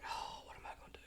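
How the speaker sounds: soft defeated whisper; barely voiced, at a loss; whispered despair. Father whose key won't turn either, whispering despair.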